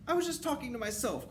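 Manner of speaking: high, pleading excuse; whimpering plea in a high voice; terrified underling witch; wretched cry. A narrator's reading. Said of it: "cried the wretched witch".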